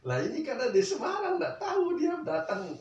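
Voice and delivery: high, loud laughing outburst. Real, not acted. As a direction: laugh it out in disbelief. Laughing outburst over the relatives. (tertawa keras tak percaya)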